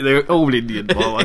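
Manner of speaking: real laugh running through the words. Real, not acted. Admitting the failed accent to co-host, laughing. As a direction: laugh helplessly at your own effort.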